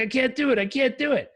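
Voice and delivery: high-pitched mock pleading; pleading panic. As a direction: imitate a panicking client begging off. Retold client pleading with coach.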